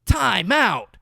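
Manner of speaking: very loud, high-pitched hammed-up dad yell; outraged dad shout. Dad steps in after daughter hits mom, hammed-up outraged shout. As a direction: bellow a parent's scolding, drawn out and over the top.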